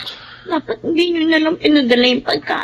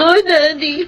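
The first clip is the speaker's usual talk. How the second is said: ordinary talk, nothing marked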